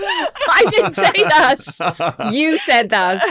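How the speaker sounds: high, loud, laughing indignation; laughing protest. Retold or imitated. Correcting HR's misquote, loud and laughing. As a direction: protest through laughter that she never said it. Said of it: "And I was like".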